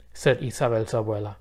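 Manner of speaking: ordinary delivery, nothing marked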